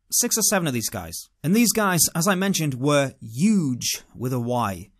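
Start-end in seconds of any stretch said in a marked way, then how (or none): none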